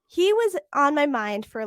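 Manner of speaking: ordinary talk, nothing marked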